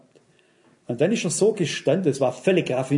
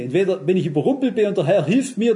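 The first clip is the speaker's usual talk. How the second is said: usual speaking voice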